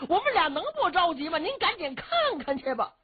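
high, hurried servant's plea; pressing, high-pitched alarm; anxious urging. Acted. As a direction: rush out an anxious appeal.

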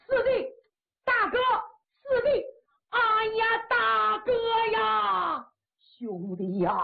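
high, tearful calls of brother; tearful reunion cry. Acted. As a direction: cry out to him through tears. Brothers reunite after ten years.

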